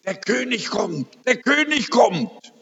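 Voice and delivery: dying wife announcing the King is coming, excited. Retold or imitated. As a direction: announce it with eager wonder. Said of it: "sagt ganz aufgeregt".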